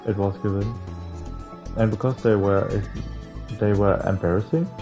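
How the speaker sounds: normal talking voice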